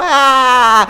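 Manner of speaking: high, loud cry; yell. Acted. Attacked mid-argument.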